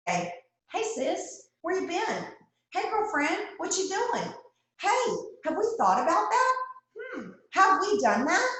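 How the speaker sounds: put-on high, bubbly girlfriend check-in; chatty girlfriend. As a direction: sing it out like a nosy best friend. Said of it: "we need a friend to say".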